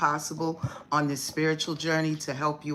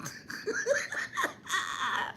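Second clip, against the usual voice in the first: squeaky breathless laugh; high-pitched wheeze of laughter; high wheezing laugh; 6 dB quieter than usual. Real, not acted. Cracking up at chat.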